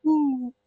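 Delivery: small high-pitched "woo"; a tiny, high imitated hoot; slight little whoop. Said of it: "was a very slight little".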